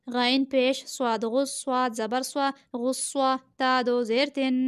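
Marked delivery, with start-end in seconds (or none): none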